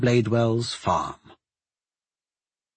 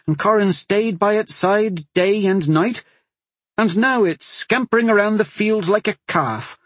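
higher, heated protest; the mother angrily defends her son to the sheriff, voice rising; angry; 7 semitones higher, 3 dB louder than usual. Acted. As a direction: let the anger rise line by line. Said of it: "She was angry".

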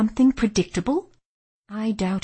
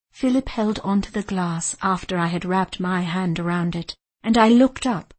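ordinary delivery, nothing marked

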